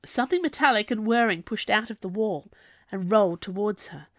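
ordinary delivery

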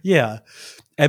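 ordinary talk, nothing marked

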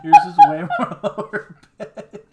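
laughing. Real, not acted. Reacting to howl attempt.

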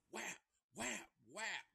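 high mock smack noises; hammer whacks. Storyteller voicing the hammer blows, high mock smacks. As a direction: slap out each hit sound.

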